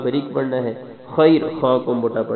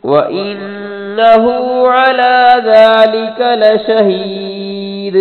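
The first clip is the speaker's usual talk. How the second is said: very slow, much higher and louder chanted recitation; full-voiced melodic Quran recitation; 9 semitones higher, 8 dB louder, much slower than usual. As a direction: sing the surah out in full tajweed melody.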